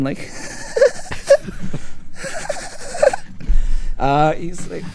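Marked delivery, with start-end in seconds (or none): 0.2-3.9 high-pitched mock giggling; eerie, very high repeated laugh; imitated laughter. Storyteller imitates laughter kicking in, high giggle. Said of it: "this faint laughing's kicking in".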